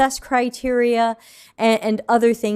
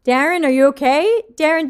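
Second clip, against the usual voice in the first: ordinary talk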